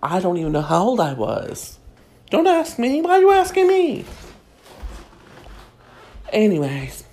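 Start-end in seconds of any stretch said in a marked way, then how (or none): 2.3-4.0 much higher and louder, fast, hammed-up indignation; mock defensive squeal. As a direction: fling it back like a diva caught out. Mock defensive outburst at audience.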